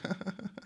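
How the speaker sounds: high, soft mock giggle; imitowany chichot. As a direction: giggle quietly and high, imitating her. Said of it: "what's giggle worthy about that".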